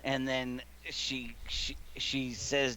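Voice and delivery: ordinary talk, nothing marked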